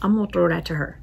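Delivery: ordinary talk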